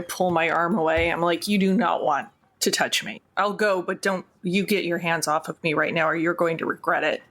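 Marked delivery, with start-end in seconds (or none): none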